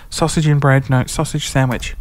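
usual speaking voice

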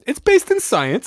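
mock sciencey claim. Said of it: "And it was like".

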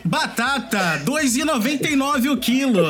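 lightly nasal price call over a store PA; nasal supermarket loudspeaker. Shown as a demonstration. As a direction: call out the price like a market announcer. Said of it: "locutor de mercado".

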